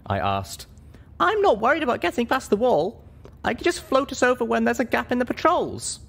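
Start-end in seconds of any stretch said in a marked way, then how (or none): 1.2-6.0 put-on much higher, lighter young mare voice; a high young pony heroine choosing her words; heroine's voice. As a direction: speak up as the small, earnest heroine. Heroine answers the Elder.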